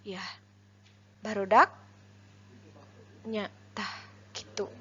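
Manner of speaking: halting words with long pauses; too slow, dawdling. Shown as a demonstration. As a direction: leave long gaps between every few words. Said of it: "Meni laun-laun teuing atawa meni ngayayai".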